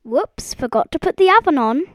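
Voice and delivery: startled cry. A narrator's reading. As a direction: cry out at your own slip. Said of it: "she cried".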